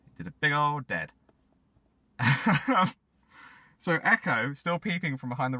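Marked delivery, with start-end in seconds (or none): 2.2-2.9 short burst of laughter; laughing. Real, not acted. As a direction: laugh at your own joke. Laugh after dead joke.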